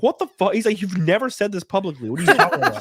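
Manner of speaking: high, laughing disbelief; incredulous Franco. Retold or imitated. Franco baffled by Wiseau's reveal, high laughing disbelief. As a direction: mimic Franco's stunned reaction with a laugh. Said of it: "James Franco looked at him and he's like".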